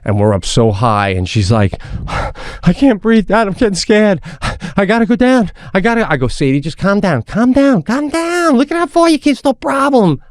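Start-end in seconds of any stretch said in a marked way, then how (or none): 2.1-6.1 high-pitched, gasping mock panic; imitated child panic. Scared daughter pleads with dad high on the mountain, imitated panic. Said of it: "she starts to panic". 6.3-10.2 climbing to a very high, strained plea; retold urgent pleading. Dad urges panicking daughter to calm down, acted-out high-pitched urgency.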